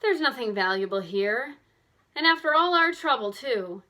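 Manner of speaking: rising in let-down frustration; a let-down girl complaining after all their trouble; disappointed complaint. A narrator's reading. Bess, let down by the box, complains with rising frustration. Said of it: "completely let down".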